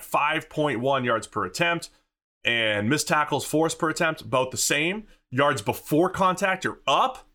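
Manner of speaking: ordinary delivery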